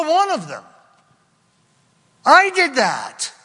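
high, loud anguished cry of loneliness; voicing a desperate David. Preacher voicing David alone in cave, anguished cry. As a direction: pour out David's despair.

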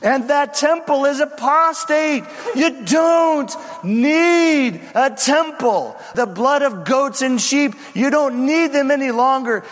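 high, stretched-out prophetic denunciation; wailing shout. Acted. Two witnesses denouncing the temple to the crowd, wailing shout. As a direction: cry it out like a street prophet, holding key words long. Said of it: "there is a wail".

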